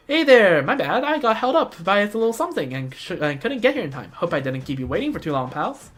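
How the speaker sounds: upbeat, lifted character greeting; breezy latecomer character. Acted. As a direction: play a chummy friend arriving late.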